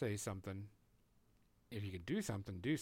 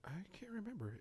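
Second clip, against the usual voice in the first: whispering; 8 semitones higher, 10 dB quieter than usual. Real, not acted. Muttering to self while searching.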